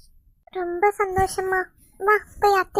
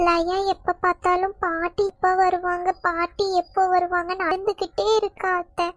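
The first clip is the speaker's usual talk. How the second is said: ordinary delivery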